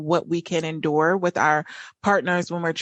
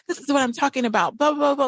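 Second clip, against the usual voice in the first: higher, faster, a touch harsh; imitating the partner snapping, hammed-up; mock abrasive partner; 8 semitones higher, faster than usual. Wife retelling her partner's abrasive jab, mock harsh. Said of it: "he was getting really abrasive".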